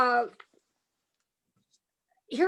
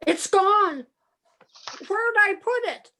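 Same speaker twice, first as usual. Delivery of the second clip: rising panic; 7 semitones higher than usual. Acted. Billy panics, can't find money.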